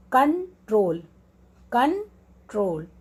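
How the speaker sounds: Control split slowly into कं and troll; slow teacher's demo of control in two beats; stress on troll. Shown as a demonstration. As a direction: pull control apart, leaning on troll. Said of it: "troll पे हमारा stress आ रहा है".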